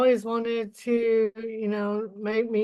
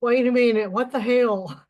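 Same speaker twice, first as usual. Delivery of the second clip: stunned; 3 semitones higher, 5 dB louder than usual. Retold or imitated. Shocked at mentor's hug.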